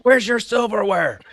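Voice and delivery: high, loud demanding shout; drill sergeant yell. Retold or imitated. Drill sergeant confronting the recruit without silverware, loud shout. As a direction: shout it in his face.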